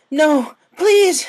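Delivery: loud, very high scream of please; terrified yell. Acted. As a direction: cry out in a nightmare. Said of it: "she yelled from the dream world".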